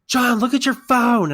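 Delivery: high, loud excited yell; imitated holler across a store; Casey. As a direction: yell urgently over loud music. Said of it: "he yells at me again".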